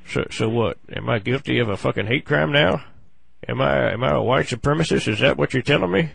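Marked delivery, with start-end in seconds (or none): none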